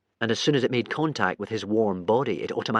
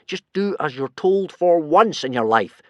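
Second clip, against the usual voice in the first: loud, slow, bossy order; firm loud command; yell; 4 semitones higher, 5 dB louder than usual. Acted. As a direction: yell at him to obey. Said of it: "yelled Hiccup".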